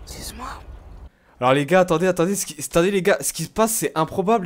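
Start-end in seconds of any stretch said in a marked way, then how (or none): 0.1-0.5 whispered apology under his breath; breathy whispered excuse-me. Real, not acted. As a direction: slip in a quick hushed sorry. Aside while others shout in game.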